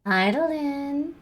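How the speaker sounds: quiet, slow coo; tender hushed cat greeting; soft pet talk. Real, not acted. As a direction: murmur it close to the cat.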